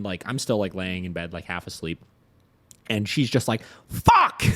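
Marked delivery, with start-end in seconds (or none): 3.9-4.4 high, loud imitated curse; mock-alarmed yell of a swear. Wife at the window finding snow, alarmed yell. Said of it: "sounded so concerned".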